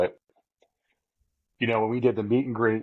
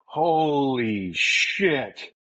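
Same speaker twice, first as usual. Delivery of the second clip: very slow, drawn-out amazement; long, stunned exclamation; awestruck; 2 semitones higher, much slower than usual. Retold or imitated. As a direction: stretch each word out in retold awe. Retells awe on seeing his idol.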